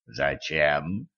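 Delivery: low, quiet and wary. A narrator's reading. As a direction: ask it cautiously, voice dropped low. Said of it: "настороженно спросил Жан".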